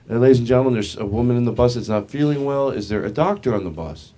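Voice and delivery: bus driver announcement. Retold or imitated. Bus driver asking passengers for a doctor, PA announcement. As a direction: address passengers over the bus speaker. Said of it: "gets on the P A system".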